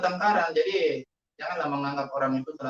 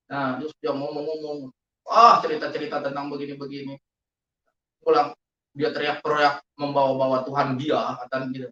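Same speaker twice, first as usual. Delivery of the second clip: ordinary delivery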